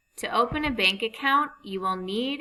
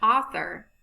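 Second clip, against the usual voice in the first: normal talking voice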